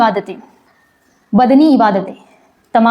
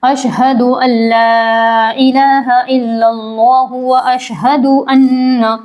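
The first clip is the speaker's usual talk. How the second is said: measured, drawn-out chanting of the tashahhud; slow Arabic recitation; much slower than usual. Acted. Teacher reciting the tashahhud slowly for students. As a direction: recite each word slowly and carefully.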